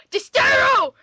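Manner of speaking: Distiro shouted high and loud; shouted name. Acted. Reading a garbled fanfic word, shouting it triumphantly. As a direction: fling out Distiro like a triumphant cry.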